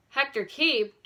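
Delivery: stunned by the name; surprised exclaim. A narrator's reading. George, surprised, repeats the name to Nancy. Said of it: "George exclaimed in surprise".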